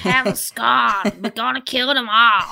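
over-the-top mock Italian gangster threat; Adrian Brody. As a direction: parody a terrible Don Corleone Italian accent. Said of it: "his terrible cod Don Corleone Italian accent".

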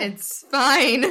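mock-fine, laughing through it; high, loud, giggly pretend reassurance; laughing denial. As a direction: insist through laughter that all is well. Joking about crying every night.

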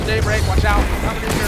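loud urgent warning shout; panicking gamer. Real, not acted. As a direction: yell a warning to your teammate.

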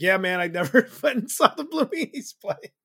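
high, loud, cracking up mid-sentence; laughing confession. Retold or imitated. Fan confessing to a band member he skipped shows, laughing. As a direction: blurt it out through embarrassed laughter.

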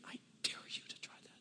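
a fast, hushed whisper; soft conspiratorial whisper; whispered dare. Real, not acted. Leans in with a dare.

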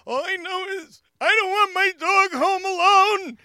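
very high, loud, drawn-out imitation crying; mock tearful wail. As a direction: wail it like a tipsy, weeping wife. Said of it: "and she starts crying".

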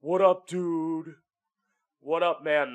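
high, loud dudebro greeting, drawn out on dude; bro impression. Straight-bar bro greeting his buddies, loud mock bro voice. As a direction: greet the room like a backslapping bro. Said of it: "Being around bros, being like".

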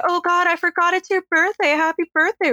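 high gushing put-on voice; gushing fake Gale. Host imitates fake Gale gushing at Roman. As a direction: gush it girlishly. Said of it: "fake Gale's like".

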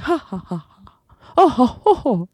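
polite ha ha then high ho ho; mock normal laugh. Shown as a demonstration. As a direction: demo a tidy little laugh. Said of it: "Like someone like".

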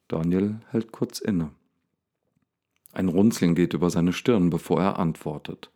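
ordinary delivery, nothing marked

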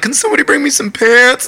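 high-pitched, helpless call for help; pleading call. Retold or imitated. Stranded singer begs his bandmates for pants, high pleading call.

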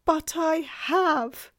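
high, loud, drawn-out confession; anguished admission. A narrator's reading. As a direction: wail the admission slowly as the faun. Faun insists on his guilt.

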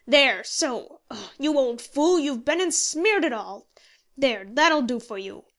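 loud, impatient scolding; exasperated scolding. A narrator's reading. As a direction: berate him for bungling it. Handy scolding the clumsy signer.

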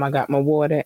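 ordinary talk, nothing marked

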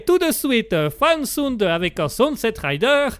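high announcer with Italian-tinged English; Italian-English accent. Acted. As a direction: present the act in botched Italian-English. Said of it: "l'accent anglais italien".

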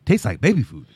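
imitated film character.

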